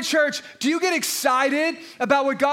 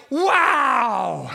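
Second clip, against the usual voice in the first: awed wow; 2 semitones lower than usual. Retold or imitated. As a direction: gasp it out in wonder. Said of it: "I'm like".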